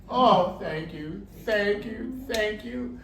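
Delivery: overflowing relief; freed tiger thanks the man, high gushing; grateful thanks. Acted.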